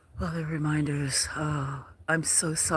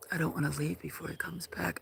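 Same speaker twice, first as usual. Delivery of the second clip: soft unvoiced night whisper; whispered worry about missing him; 2 semitones higher, 7 dB quieter, faster than usual. Real, not acted. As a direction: keep it hushed outdoors.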